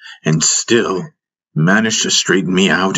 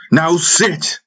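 firm command; 10 semitones higher than usual. A narrator's reading. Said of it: "he said".

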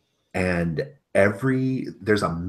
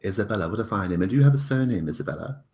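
a hint of a pompous host, raised; lightly voiced fussy lord; 2 semitones higher, 7 dB louder, faster than usual. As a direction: ask with mild pompous charm.

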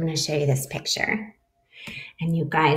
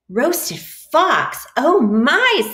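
alarmed fox; 9 semitones higher, 7 dB louder, much slower than usual. Acted. Fox frightened by mouse.

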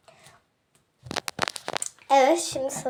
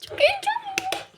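squeaky high excited squeal. Real, not acted. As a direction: gush it out in delight. Excited child vlogger squeal. (heyecanlı ciyaklama)

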